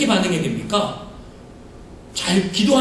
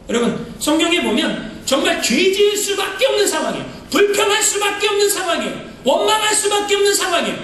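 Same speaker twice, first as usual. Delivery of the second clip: full-force preaching; 9 semitones higher than usual. Real, not acted. Pastor stressing Job's hopeless situation, fervent preaching. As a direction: drive it home to the congregation.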